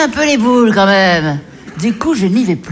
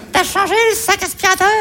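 mimicking the husband; 10 semitones higher, faster than usual.

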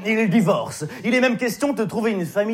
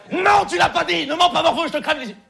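yelling; 7 semitones higher, 4 dB louder than usual. Acted. Monitor yells at child.

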